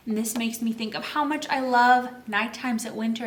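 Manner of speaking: usual speaking voice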